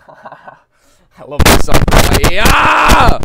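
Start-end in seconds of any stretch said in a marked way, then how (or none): 2.3-3.2 high, loud shout; excited shout. Real, not acted.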